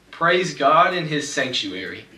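usual speaking voice

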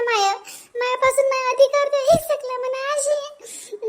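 bitter wife's lament. Acted. A wife complaining alone that her family forgot her, aggrieved and high. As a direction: pour out the hurt of a wife left behind.

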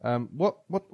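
ordinary delivery

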